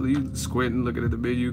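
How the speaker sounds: ordinary talk, nothing marked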